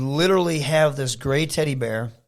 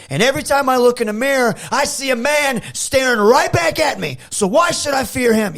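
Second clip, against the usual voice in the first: put-on higher, louder, fired-up babyface promo; wrestling promo; 7 semitones higher, 3 dB louder than usual. Shown as a demonstration. Wrestler demoing his house promo, fired up and louder. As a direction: rhetorical questions building to a defiant punch. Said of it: "I walk around my house cutting promos".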